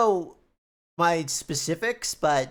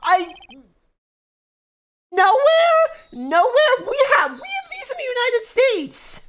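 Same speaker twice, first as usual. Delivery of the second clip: incredulous; 7 dB louder than usual. Real, not acted. Incredulous outburst about sliding doors.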